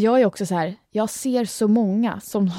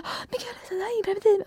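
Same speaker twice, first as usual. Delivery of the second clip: much higher, exaggerated dramatic whine; dramatic retort; 12 semitones higher, 4 dB quieter, much faster than usual. Answering mother about contraception, high mock-dramatic whine. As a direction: ham up an exasperated teenage reply. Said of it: "hon är ju så jävla dramatisk".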